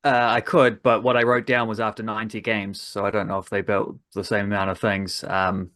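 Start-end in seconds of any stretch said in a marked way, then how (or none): none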